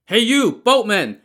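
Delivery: loud angry yell across the water; shouting. A narrator's reading. Pursuers shouting at the boatman, loud. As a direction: bellow from the riverbank. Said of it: "they shouted".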